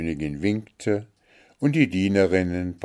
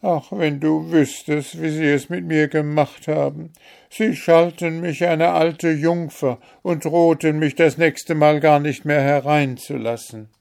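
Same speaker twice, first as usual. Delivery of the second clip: high, louder plaintive outpouring; wounded daughter's complaint, lightly tearful; 9 semitones higher, 7 dB louder than usual. A narrator's reading. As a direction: lament your mistreatment to your mother. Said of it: "weinte Märchen".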